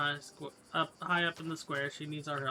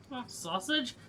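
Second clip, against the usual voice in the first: high, rising, unsure question; is that really you; a high questioning call; surprised recognition; 8 semitones higher, slower than usual. Acted. Spots long-lost friend.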